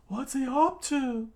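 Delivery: very high falsetto question; put-on cartoon squeal; high-pitched onlooker voice. Acted onlooker.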